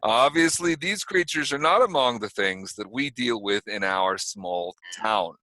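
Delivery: put-on sheriff's official address in a New York accent. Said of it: "speaking in a New York accent".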